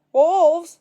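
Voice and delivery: loud alarmed yell; cried out. A narrator's reading. Villager hearing the word, crying out alarmed. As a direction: yell it from far below. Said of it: "someone down at the village cried out".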